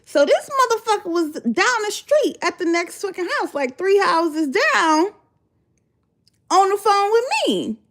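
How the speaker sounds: high, incredulous outrage; can't believe this man; outraged. Real, not acted. Outraged retelling.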